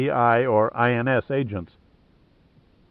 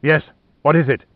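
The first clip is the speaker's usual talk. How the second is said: sharp, louder snap; snapped; 3 semitones higher, 4 dB louder than usual. Acted. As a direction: snap it impatiently. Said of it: "he snapped".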